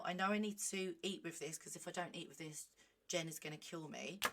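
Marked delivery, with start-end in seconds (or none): none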